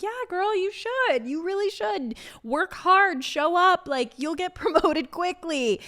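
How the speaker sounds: high, sing-song encouragement; chirpy fake confidence. Telling waitresses to work hard, high and breezy. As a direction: play the breezy mentor.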